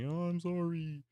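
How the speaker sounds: high, put-on little apology; mock apology. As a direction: squeak out a pretend sorry. Mock apology to YouTube's scolding.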